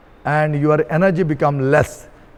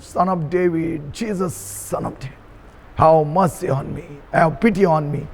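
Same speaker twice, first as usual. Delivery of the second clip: usual speaking voice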